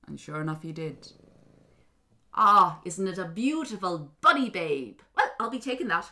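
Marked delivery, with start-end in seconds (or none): 2.3-6.1 little leprechaun claiming his prize; gloating little man. Acted. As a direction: gloat over the baby like a greedy imp.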